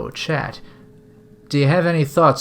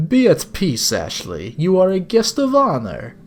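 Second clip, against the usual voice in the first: badly accented sinister gentleman; villainous older captor; 5 semitones higher than usual. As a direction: savour it like a courteous kidnapper. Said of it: "sorry if this is a bad accent".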